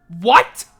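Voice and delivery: loud, incredulous what; shocked what. Real, not acted. Reacting to Nebraska's record, loud disbelief. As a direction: blurt out disbelief.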